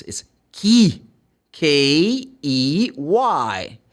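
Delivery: K E Y spelled out slowly; slow clear spelling; careful spelling. Shown as a demonstration. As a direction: spell out key letter by letter. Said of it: "be careful with this".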